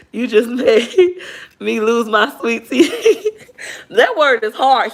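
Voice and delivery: slow, laughing, high; laughing while reading. Real, not acted. Reading a funny comment aloud, laughing. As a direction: read it through laughter.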